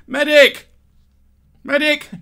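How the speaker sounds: high, loud shout for a medic; mock battlefield cry. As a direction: yell out like a wounded soldier, hammed-up.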